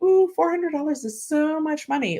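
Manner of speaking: high, sarcastic gush over a small sum; mock gushing. Mocking people impressed by a small rebate, sarcastic high gush. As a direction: gush mockingly, like it's a fortune. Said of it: "they wanna be like".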